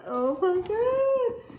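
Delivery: high, drawn-out squeal of delight; thrilled, voice soaring up; excited squeal. Real, not acted. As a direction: stretch it slowly into a high squeal. Seeing the inside of the bag.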